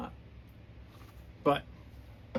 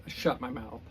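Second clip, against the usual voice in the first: ordinary delivery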